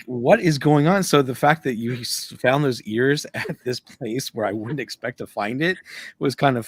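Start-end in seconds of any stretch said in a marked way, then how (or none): 0.1-1.0 higher, louder retold disbelief; bafflement. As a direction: act out being utterly puzzled. Retells own bafflement in the store.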